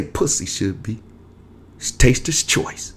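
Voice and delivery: breathy whispered angel voice; soft angel voice. Retold or imitated. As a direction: hush it like an angel in a vision. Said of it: "the angel speak in a soft voice".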